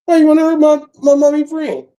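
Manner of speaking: very high mock child voice; squeaky kid imitation, pleading; mimicked little girl. Little girl defending mommy's friend, squeaky mock plea.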